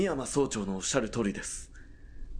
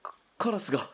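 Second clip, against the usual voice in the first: shaken stammer; 4 semitones higher than usual. Acted. Shaken driver after crow impact.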